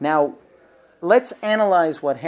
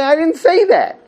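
raised, louder protest; exasperated protest; 10 semitones higher, 7 dB louder than usual. Retold or imitated. Husband objecting to his wife, loud exasperation. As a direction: push back hard.

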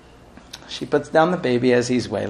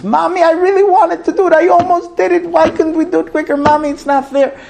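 put-on a high, loud child's crying complaint; wailing toddler; 13 semitones higher, 4 dB louder than usual. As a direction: wail it out like an upset little kid. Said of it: "wailing at the top of his lungs".

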